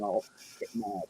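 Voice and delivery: ordinary talk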